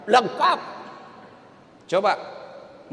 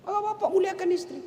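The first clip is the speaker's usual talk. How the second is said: ordinary delivery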